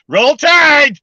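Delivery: high, loud football chant; cheering. Pretend. Echoing the Alabama cheer for Blaine, excited high shout. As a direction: belt out the cheer like a fan in the stands.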